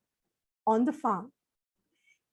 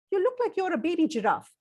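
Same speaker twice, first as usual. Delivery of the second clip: plain reading voice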